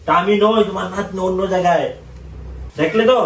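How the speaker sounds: shouting high to a deaf grandmother; loud and high so granny hears; to someone hard of hearing. Acted. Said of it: "কানে শোনে না".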